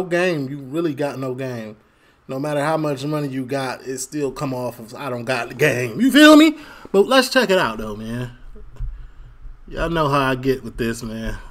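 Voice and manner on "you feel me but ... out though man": usual speaking voice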